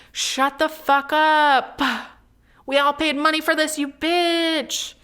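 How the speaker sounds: mock furious. Imagined telling off the talking couple, mock furious. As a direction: drag out every word like a threat.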